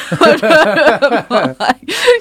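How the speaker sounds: loud, high-pitched laugh; real laugh ringing out after a quip. Real, not acted. Laughs at own remark about riding again.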